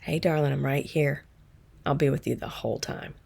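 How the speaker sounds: soft, tender reassurance from mom; whisper-close comfort, the mother soothing her child; mother's reassuring voice. Retold or imitated. Mom comforting her daughter during the scan, tender and soft. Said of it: "I heard her voice".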